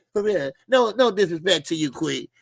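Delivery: usual speaking voice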